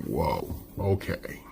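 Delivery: soft, slow, stunned under the breath; hushed slow realization. Retold or imitated. Macbeth looking back at the end, hushed and slow. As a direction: let it dawn on you quietly. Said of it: "he's going".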